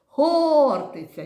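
ХОРТИЦЯ with ХОР high, loud and held; calling out the word. Shown as a demonstration. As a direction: call ХОРТИЦЯ out slowly, leaning on О. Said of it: "Давайте ще погукаємо".

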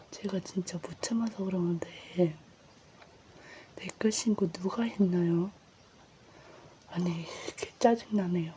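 whispering an annoyed complaint; whisper, irritated underneath; ASMR whisper. Real, not acted. Whispered complaint about reports. (짜증 섞인 속삭임)

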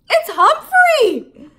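put-on high, loud, slow child's yell; excited yell. A narrator's reading. Miranda yells to the class, high, loud and slow. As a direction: draw it out as a thrilled discovery. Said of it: "she yelled".

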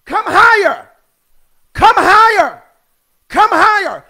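loud, high repeated summons; calling out. Acted. Preacher voicing the throne's call to John, loud calling out. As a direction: belt it out like a voice from above. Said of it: "The voice is saying".

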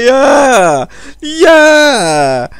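loud, high, drawn-out whoop; hammed-up cheering shout; cheering dad. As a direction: roar along as an overjoyed mock dad. Imagined dad cheers daughter on.

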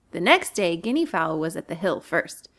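ordinary delivery

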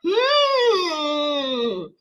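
high, drawn-out taunting hum; mocking long hum. Streamer taunts viewers who came to look, mocking long hum. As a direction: stretch a hum that slides down from high pitch.

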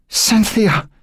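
panicked cry for help; cried out. A narrator's reading. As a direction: call out for her as you fall. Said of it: "she cried out".

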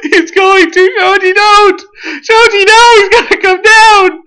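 high, urgent wail; panicked warning. Real, not acted. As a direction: beg a teammate to stop, high and frantic.

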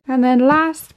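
ordinary talk, nothing marked

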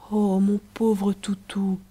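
slow, whispered consolation; tender murmur. A narrator's reading. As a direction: whisper it slowly, soothing a crying loved one. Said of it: "murmura Riquette en essuyant les larmes de Riquet". (murmure tendre et lent, chuchoté)

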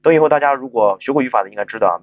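ordinary talk

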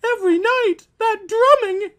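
frantic wife. Acted. Wife despairing over the nightly drumming, a frantic high cry. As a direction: wail about the noise.

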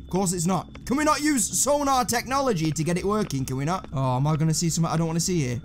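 ordinary delivery

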